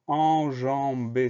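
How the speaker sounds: "enjambé" stretched slowly into en-jam-bé; slow, deliberate "enjambé" with each syllable separate. Shown as a demonstration. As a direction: sound out "enjambé" one syllable at a time. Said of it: "Première syllabe".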